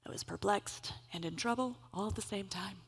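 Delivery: ordinary talk, nothing marked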